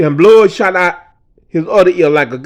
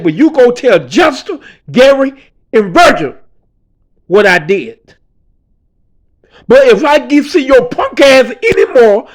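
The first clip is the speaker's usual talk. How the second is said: angry gang enforcer; 3 semitones higher, 6 dB louder than usual. Retold or imitated. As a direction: warn him off hard and send him away. Enforcer dismissing speaker with a warning.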